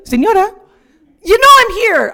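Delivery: loud, high, insistent call into the phone. Retold or imitated. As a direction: demand an answer from the silent line. Calling to silent phone agent.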